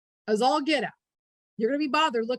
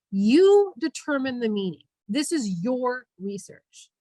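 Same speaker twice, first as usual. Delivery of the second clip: YOU and YOUR punched out; emphatic, drawn-out YOU and YOUR; stress on YOU and YOUR; 5 dB louder than usual. Shown as a demonstration. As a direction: lean hard on "You" and "your". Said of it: "Did you hear I emphasize you and your?".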